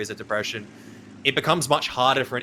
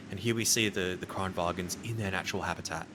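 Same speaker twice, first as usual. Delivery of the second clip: lower, hushed nature documentary narrator; David Attenborough voice; 5 semitones lower, 6 dB quieter than usual. Retold or imitated. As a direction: narrate it like a wildlife film, low and hushed. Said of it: "I thought you were gonna go into a David Attenborough kind of, like, impression".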